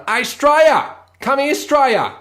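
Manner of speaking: loud, high yell across the yard; calling out. Retold or imitated. Bogan townsfolk calling the kid, loud shout. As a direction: bellow the name to call him over.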